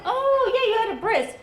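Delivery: high, loud dawning 'oh'; acted moment of remembering; sudden realization. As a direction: leap up high as it clicks. Mother suddenly realizes, retold.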